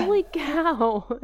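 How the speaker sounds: high, slow, drawn-out exclamation; amazed. Real, not acted. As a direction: gasp it out in amazement. Shocked reaction.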